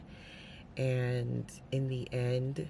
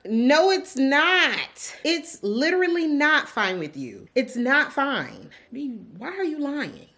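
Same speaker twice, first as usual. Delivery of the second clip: exasperated outburst; 11 semitones higher, 9 dB louder than usual. Real, not acted. Yelling at character lying about feelings.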